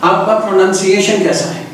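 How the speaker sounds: ordinary talk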